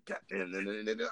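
softly muttered mock complaint; muttering under breath. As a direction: mimic someone grumbling to himself. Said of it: "he talking in his breath".

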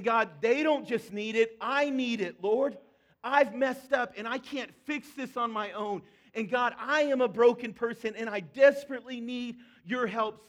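loud, pleading prayer to God; impassioned plea. Acted. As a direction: cry out desperately for help. Voicing Jonah's prayer, loud.

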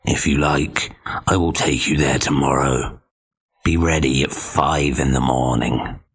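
low growly wolf voice. Acted. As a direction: growl trying to sound nice. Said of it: "his voice was growly".

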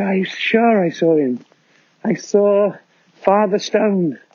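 put-on high, wide-eyed kid; a child swearing it was real; insistent child voice.

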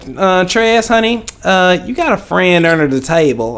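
imitating Taylor's light Southern accent. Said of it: "she got this southern, like, little accent".

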